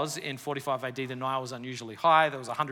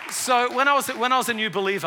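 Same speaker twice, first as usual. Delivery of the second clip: excited restart; 9 semitones higher, 9 dB louder than usual. Real, not acted. Restart much higher and louder.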